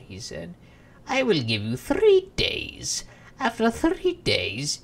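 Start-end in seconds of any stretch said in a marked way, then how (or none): 1.1-4.8 menacing imp. Acted. Imp sets the deadline.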